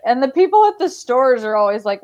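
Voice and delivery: ordinary talk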